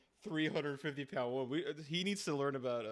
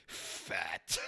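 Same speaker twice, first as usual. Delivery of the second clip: disgusted mutter; 11 semitones lower than usual. Retold or imitated. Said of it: "He's like".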